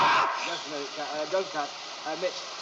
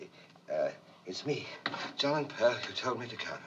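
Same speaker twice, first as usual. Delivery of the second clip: normal talking voice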